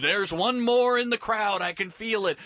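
mock a pastor pleading with the crowd; pastor's fervent plea. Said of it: "and the pastor said".

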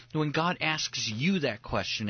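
usual speaking voice

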